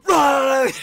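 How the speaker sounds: loud, high wordless roar; explosive mock coach roar; sudden yell. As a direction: snap into a screaming outburst. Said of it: "Start yelling".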